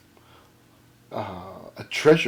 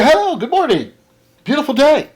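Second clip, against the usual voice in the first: bright, high, loud hello; cheery greeting; 11 semitones higher, 5 dB louder than usual. Retold or imitated. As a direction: greet him with over-the-top cheer. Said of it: "I say".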